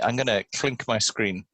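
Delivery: normal talking voice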